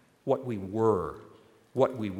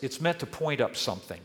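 normal talking voice

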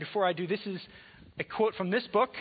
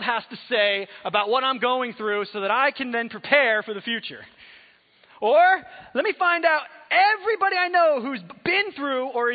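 mock frantic planning; 4 semitones higher, 6 dB louder than usual. Acting out his own anxious planning.

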